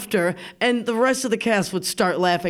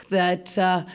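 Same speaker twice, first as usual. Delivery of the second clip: ordinary delivery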